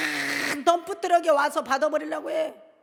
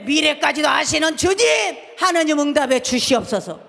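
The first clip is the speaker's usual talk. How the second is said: loud, higher pleading prayer; shouted prayer; 5 semitones higher, 6 dB louder than usual. Shown as a demonstration. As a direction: beg God for an answer. Models repentant prayer aloud. (간절히 외치는 기도)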